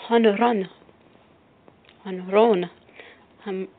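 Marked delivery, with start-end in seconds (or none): 0.1-2.7 "Home run" as o-no-RON, louder; Spanish home run. Shown as a demonstration. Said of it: "Spanish then English".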